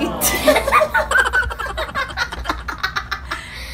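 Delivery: high loud laugh at her slip; burst of laughter. Real, not acted. Laughing at her own mistake in the challenge. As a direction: cracks up laughing.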